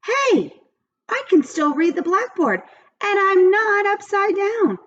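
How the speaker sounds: put-on high, wondering kid voice with a gasp; amazed John. John amazed after falling.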